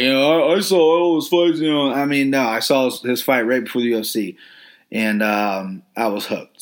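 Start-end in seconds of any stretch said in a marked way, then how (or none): 0.5-1.6 mock bragging. Podcaster disowning a know-it-all fan, mock boastful voice. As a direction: imitate someone showing off, pitched up. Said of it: "I don't wanna sound like".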